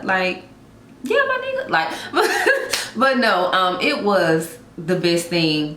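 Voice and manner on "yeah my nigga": much higher, joking imitation of her ex; mock ex's voice. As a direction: toss it off in a high teasing voice as him. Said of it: "even he was like".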